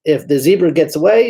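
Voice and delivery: ordinary delivery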